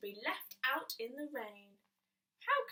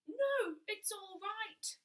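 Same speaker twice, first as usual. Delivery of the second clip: calling out; 8 semitones higher, 3 dB louder, slower than usual. Acted. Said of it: "called the ghost".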